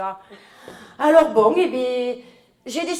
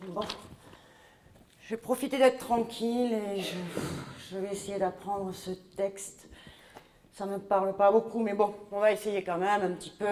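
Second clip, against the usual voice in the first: normal talking voice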